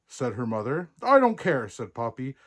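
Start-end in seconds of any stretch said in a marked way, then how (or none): 1.0-1.7 high, loud defiant retort; furious princess. A narrator's reading. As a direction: fire back like a stroppy princess. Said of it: "Princess Poppy was furious".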